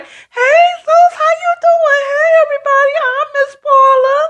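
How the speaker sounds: breeze in all fake-sweet and chirpy; cheery. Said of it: "she's cheery".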